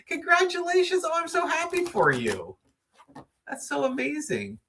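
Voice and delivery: high, laughing congratulations; gushing joy. Real, not acted. Celebrating a viewer's big milestone, gushing and laughing. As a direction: gush over her good news, laughing.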